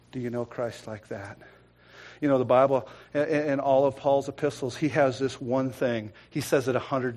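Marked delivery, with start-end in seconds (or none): none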